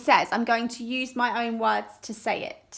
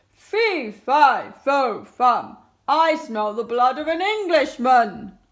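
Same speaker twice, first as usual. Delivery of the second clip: booming giant bellow, much louder; 9 dB louder, slower than usual. Acted. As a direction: roar it like a huge giant. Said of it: "he bellowed".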